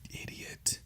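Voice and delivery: very soft whisper; whispered self-scolding. Real, not acted. Talking to himself while he edits this, whispered self-insult. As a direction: whisper it privately to yourself. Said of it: "I'm talking to myself".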